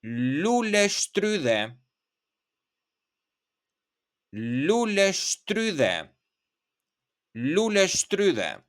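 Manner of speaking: lule strudel drawn out at half speed; slow word demo. Shown as a demonstration. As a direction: spell out lule strudel slowly for learners. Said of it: "you can say".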